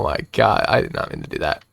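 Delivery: whispered, breathless apology; embarrassed whisper. Real, not acted. To chat after a slip, an embarrassed whisper.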